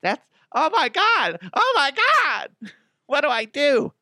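hammed-up horrified shriek; shocked listener. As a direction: squeal in mock panic. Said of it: "Be like".

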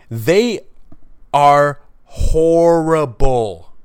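a pause after They, then horrible stretched and loud; stress on horrible. Real, not acted. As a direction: lean hard into the word horrible. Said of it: "I have to stress the word horrible as much as possible".

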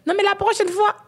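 high mocking quote of pundits; sing-song the excuse in a high voice. Mimics TVA commentators.

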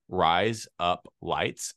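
"Rise up lights" word by word, very slowly; slow demonstration. Shown as a demonstration. Teaching an Australian accent trick, slow word-by-word demo. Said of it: "it sounds like razor blades in Australian".

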